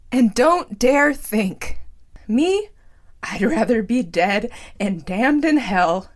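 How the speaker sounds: loud, high ranting with a shaking voice; shaking shout. Acted. Clown ranting at his audience, loud and shaking. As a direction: rage at the viewers, near tears. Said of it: "recover his voice, which had begun to shake".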